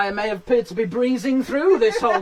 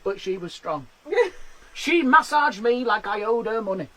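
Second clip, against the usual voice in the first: usual speaking voice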